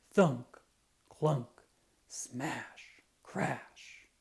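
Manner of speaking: breathy hushed smash and crash; whispered sound words. A narrator's reading. Narrator hushes the trash crashing sounds, whispered. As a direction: whisper the noises like a secret.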